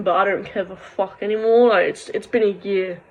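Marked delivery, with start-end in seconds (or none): none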